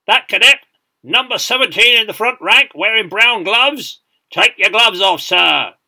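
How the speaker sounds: very loud, high parade-ground command; shouted drill order. A narrator's reading. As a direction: call out a cadet far down the ranks.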